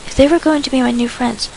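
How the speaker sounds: usual speaking voice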